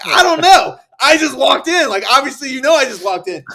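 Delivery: loud, high, flustered answer; X O yelling back at the screaming commander; flustered reply. Retold or imitated. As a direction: protest loudly that you just arrived. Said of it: "And I'm like".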